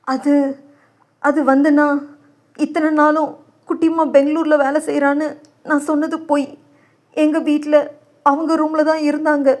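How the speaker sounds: high, faltering, hushed admission; hesitant confession. Acted. Guilty father admits his lie to his brother, faltering.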